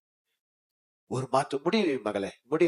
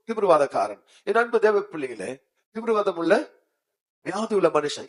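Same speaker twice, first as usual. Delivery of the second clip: ordinary talk, nothing marked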